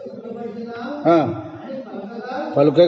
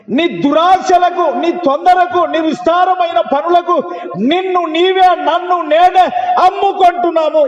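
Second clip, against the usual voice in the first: high, slow preaching at full force; fervent preaching; 10 semitones higher, slower than usual. Real, not acted. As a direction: pound each phrase from the pulpit. Preacher rebuking the congregation.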